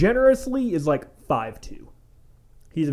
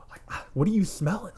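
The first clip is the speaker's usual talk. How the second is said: hushed denial; 4 semitones higher, 4 dB quieter, faster than usual. Retold or imitated. Stoner friend denying the smell.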